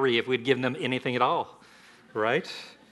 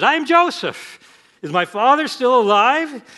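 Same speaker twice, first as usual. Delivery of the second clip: much higher and louder, slowed on I am Joseph; overcome Joseph; 12 semitones higher, 11 dB louder than usual. Acted. Joseph revealing himself to his brothers, loud and high emotion.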